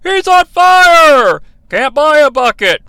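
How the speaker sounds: high, loud, slow arcade-game announcer call; hyped arcade commentator; NBA Jam announcer. Retold or imitated. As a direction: belt it out like a basketball video game. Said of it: "just like the N B A Jam announcer".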